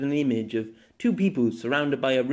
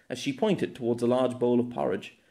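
ordinary delivery, nothing marked

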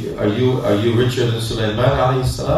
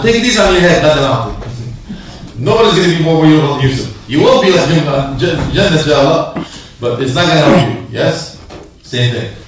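usual speaking voice

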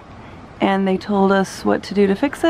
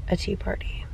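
whisper; 3 semitones lower, 9 dB quieter than usual. Real, not acted. Mom whispering plans near sleeping daughter.

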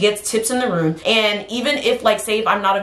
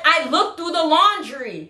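mom voice; 7 semitones higher, slower than usual. Retold or imitated. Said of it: "I said".

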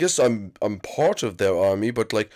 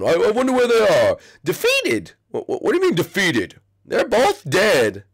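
put-on high, bratty Koopa kid voice; Iggy voice; 7 semitones higher than usual. Iggy briefing the visitor on his island plan, bratty and high. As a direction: talk like a bratty little Koopa prince.

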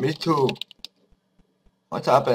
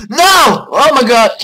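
dismayed shout; 10 semitones higher, 8 dB louder than usual. Real, not acted. Dismay at game failure.

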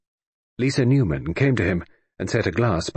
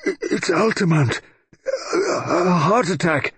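slow, halting and higher; stammered; 9 semitones higher, slower than usual. A narrator's reading. Frail professor stammers out the news, slow and high. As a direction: get the words out slowly, haltingly. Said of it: "Shoreham stammered out".

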